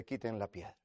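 low, very soft order; understated, hushed instruction; quiet command. Acted. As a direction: say it softly and low, almost in passing. Jesus quoted, first take.

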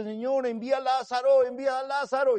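loud, high plea; loud, high begging of the rich man. Acted. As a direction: cry out please. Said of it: "le dice".

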